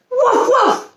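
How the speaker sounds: high-pitched puppy woofs; puppy bark. A narrator's reading. Puppy barking at a butterfly as it flies away, high woofs. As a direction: bark like a little dog, high. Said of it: "Lucky barked".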